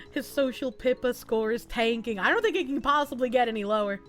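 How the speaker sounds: usual speaking voice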